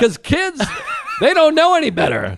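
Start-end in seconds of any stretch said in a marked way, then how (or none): 0.7-1.1 squeaky laugh; a sudden high giggle; high burst of laughter. Real, not acted. Laughing about the little kid, a high giggle.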